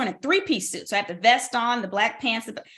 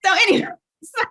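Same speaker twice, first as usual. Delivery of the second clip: laughing it off, higher and louder; 10 semitones higher, 6 dB louder, faster than usual. Real, not acted. Laughing off her tangent about medication, to the host, laughing. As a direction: brush the tangent aside with a laugh.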